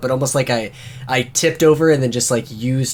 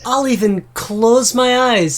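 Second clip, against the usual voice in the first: cocky taunting fighter; 9 semitones higher, slower than usual. Acted. Player character taunts the giant brother, high and slow. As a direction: goad the big man, teasing him to try.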